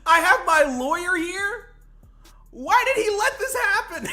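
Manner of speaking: high, loud squeal through laughter; shrieked question, cracking up; incredulous laughing. Real, not acted. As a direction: echo it in hysterical disbelief. Repeats clip line in disbelief.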